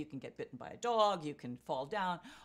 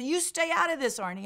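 loud high snarl at Arnie; angry shout; 9 semitones higher, 10 dB louder than usual. Retold or imitated. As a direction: bark at him to back off. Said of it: "he said".

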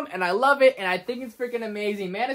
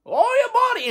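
higher, louder, muffled menacing villain; Bane impression; 13 semitones higher, 5 dB louder than usual. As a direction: fan doing Bane's fight taunt. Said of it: "I'm sorry for all the Bane impressions".

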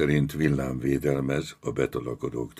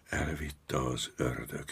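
hushed, slow, awed murmur; frightened stammer; 9 dB quieter, slower than usual. A narrator's reading. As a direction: say it very softly, stunned. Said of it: "hebegte az egyik".

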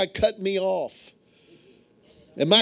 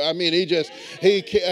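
ordinary talk, nothing marked

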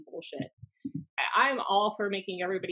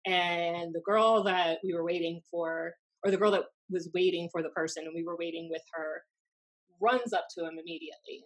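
usual speaking voice

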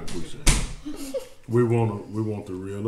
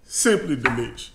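fighting back tears of pride in his sister; weepy, breaking voice; real crying; 6 semitones higher than usual. Real, not acted. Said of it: "it's making me cry every time I eat a bite".

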